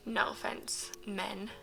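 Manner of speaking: very soft, near-whispered apology; hushed, guilty little aside; sheepish whispered aside. Real, not acted. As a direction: drop to a tiny apologetic murmur.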